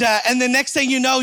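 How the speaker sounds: ordinary talk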